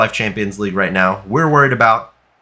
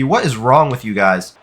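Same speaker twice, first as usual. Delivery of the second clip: usual speaking voice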